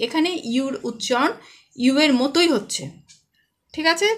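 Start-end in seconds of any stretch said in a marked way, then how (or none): none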